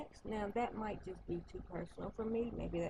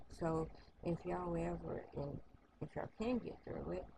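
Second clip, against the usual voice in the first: ordinary delivery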